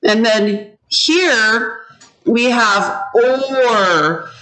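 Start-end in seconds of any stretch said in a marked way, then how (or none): 3.1-4.3 "or" stretched slowly to show the O; drawn-out vowel. Shown as a demonstration. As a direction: hold the O in "or". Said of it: "So does this O".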